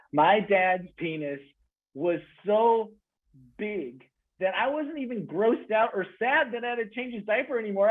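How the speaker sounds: normal talking voice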